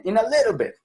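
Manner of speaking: English "In a little bit" said high; high-pitched little bit. Shown as a demonstration. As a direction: raise the pitch on "little" and drop it. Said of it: "fíjense cómo cuando uno dice eso".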